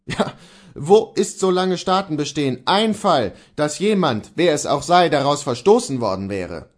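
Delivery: indignant Luther. Acted. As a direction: rage at his madness.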